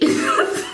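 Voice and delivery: breathy squeaky giggle; high nervous giggle. Real, not acted. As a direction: giggle from nerves.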